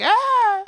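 high mock yelp of fright; mock scream. Friend mocking James's scream at the spider, high yelp. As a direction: squeal it high like a scared guy. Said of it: "Was he like".

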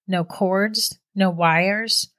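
plain reading voice

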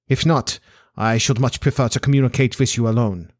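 put-on formal Central European-accented nobleman; accented aristocrat stating his terms; Bohemian Count. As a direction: play a grave, formal foreign Count. Voices the Count as a character.

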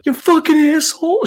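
high-pitched mock cursing yell; imitated yell. Retelling a girl cursing at the football game, mock yell. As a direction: mimic a small fan yelling abuse. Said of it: "so she yelled at one time".

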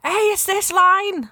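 high, slow pretend yell at the actors; mock yell. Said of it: "you could just yell at them".

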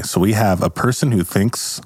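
normal talking voice

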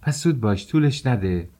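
high, impatient hurry-up. Acted. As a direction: give in grudgingly and press her to hurry. Impatient reply to nanny's plea.

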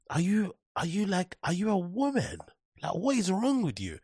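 high-pitched mocking disbelief; incredulous challenge. Mock challenge to men in pairs.

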